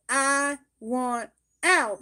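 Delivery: loud, drawn-out plea to get out; emphatic demand. Acted. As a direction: speak for someone trapped, slow and loud. Voices what the trapped person would say.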